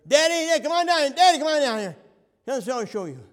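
put-on high, loud boy's shout for daddy; child calling out. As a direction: yell for dad from down the shop. Boy calling his dad down.

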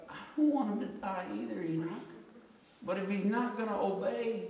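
put-on high-pitched protest to a child; retold pleading. Retold answer to weeping son.

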